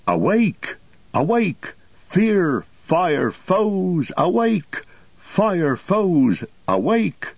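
high, ringing alarm call; horn cry. A narrator's reading. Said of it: "up went the horn cry".

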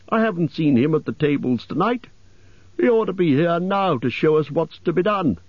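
high-pitched elf voice; high, fussy elf grumble; complaining elf. A narrator's reading. Elf complains of absent butler.

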